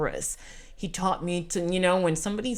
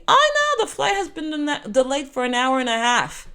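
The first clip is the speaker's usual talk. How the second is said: sing-song mock regret, much higher and louder at the start; mocking gate agent; 8 semitones higher, 5 dB louder than usual. Gate agent taunting the late traveller, mocking sing-song. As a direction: imitate an agent faking sympathy. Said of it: "mocking me from beginning to end".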